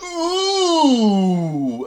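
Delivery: sudden very high, loud squeal of a yelp; mock high squeal. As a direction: squeal out like something just tore. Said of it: "and he's like".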